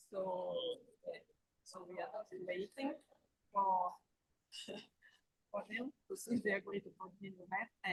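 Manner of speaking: faint broken phone signal; corrupted glitchy audio. Real, not acted. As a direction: voice fading in and out on a bad line. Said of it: "We still don't have very good sound from you".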